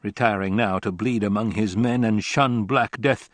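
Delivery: usual speaking voice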